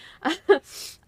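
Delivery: embarrassed laugh through tears; teary laugh. Real, not acted. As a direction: laugh while crying. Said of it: "sorry, I'm crying".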